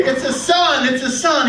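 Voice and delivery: high, loud mock nurse's announcement; excited announcement. Nurse announcing a birth to waiting father, excited call. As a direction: call out the happy news, acted.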